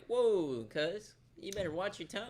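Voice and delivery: high, loud mock warning; affronted warning. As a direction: react as if insulted by the word. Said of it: "There's something a little backhanded about it".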